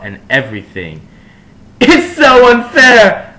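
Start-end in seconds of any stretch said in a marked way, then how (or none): 1.8-3.3 high, loud, slow wail; shrill, slow teenage wail; yelled. A narrator's reading. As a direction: wail it like a sulky teen. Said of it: "I yielded".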